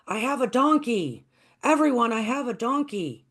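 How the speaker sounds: boy calling to the village, joyful mock shout; excited shouting. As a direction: shout the news to the whole village. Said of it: "screaming out".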